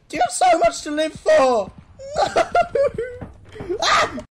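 high, loud laugh-screaming; panicked laughing scream. Real, not acted. Creature grabs him.

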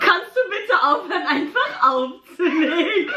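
pleading through laughter; laughing. Real, not acted. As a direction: laugh out the complaint. Laughing after being hung up.

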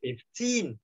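put-on "Fifteen" with TEEN lifted and long; stress on second syllable. Shown as a demonstration. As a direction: push FIF down, lift TEEN in "Fifteen". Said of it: "trọng âm nó sẽ rơi vào âm hai". ("Fifteen" hạ âm đầu, nhấn âm "teen")

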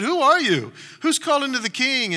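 high, loud startled reply; a guard calling back loud and high; called back. Acted. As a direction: shout back into the dark. Abner answering David's call.

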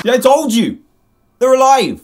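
loud, high exclamation; alarmed outburst. Real, not acted. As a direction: cry out in alarm at the screen.

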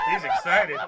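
high laughing squeal; excited squeal. Real, not acted. Repeats the tease even higher.

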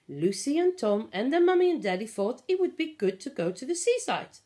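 ordinary delivery, nothing marked

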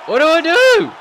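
real panic climbing into a high yell; rising panic. Real, not acted. Escalating panic in submission.

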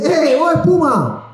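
high slow calling out "¡Eh, Bob Espuma!"; excited call across the square. Retold or imitated. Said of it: "No, perdón, me confundí".

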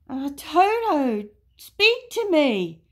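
high, loud, drawn-out plea; worried animal character calling out; alarmed pleading. A narrator's reading. Boo-hoo pleads with hurt Toto.

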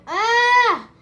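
very loud, high acted scream; sudden full-voiced shriek in the story. Story character screams, opening door.

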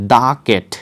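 The cat, "The" said "daa". Shown as a demonstration. Teacher modelling the article before a consonant, "daa cat". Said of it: "তাহলে কি হবে?".

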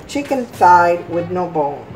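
ordinary talk

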